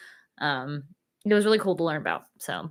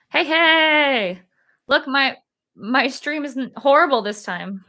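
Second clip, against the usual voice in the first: excited; 7 semitones higher, 6 dB louder, slower than usual. Real, not acted. Delighted stream finally works.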